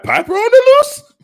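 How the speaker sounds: very high, loud squealed imitation; mock shriek. Host reading out how she said it, high mock shriek. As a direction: squeal it like a hammed-up alarm. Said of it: "I don't know why I read it like that, but I'm pretty sure that's the way she said it".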